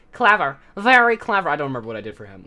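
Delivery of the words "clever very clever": high, loud, mocking villain; sinister flower voice. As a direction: sneer at the clever child. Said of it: "He's like".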